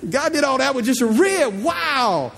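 high, awed exclamation; amazed. Real, not acted. Preacher marvels at what God made from a rib, amazed.

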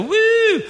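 high rising "woo"; a high, drawn-out whoop; playful whoop. Acted. Preacher teasing middle children sandwiched in between, playful whoop.

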